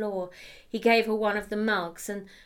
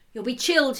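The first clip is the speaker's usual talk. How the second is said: lightly raised voice calling from the galley; calling out to the shivering woman; called out; 4 semitones higher, 6 dB louder, faster than usual. Acted. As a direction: call it through to the next room. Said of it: "he called".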